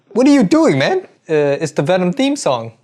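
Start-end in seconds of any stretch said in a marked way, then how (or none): 0.1-1.1 high-pitched puzzled heckler voice; a higher second voice cutting in, confused; baffled heckler. Acted. Off-screen voice heckling the singer, high and puzzled.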